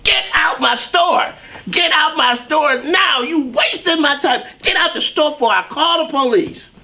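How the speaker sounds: mock-furious clerk kicking him out; angry throw-out.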